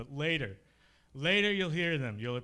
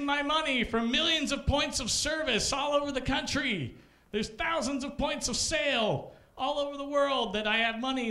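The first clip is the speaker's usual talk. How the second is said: mock outraged customer; 11 semitones higher than usual. Comic imitating a furious Bank of America customer, shouting. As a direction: rant as if cheated by the bank.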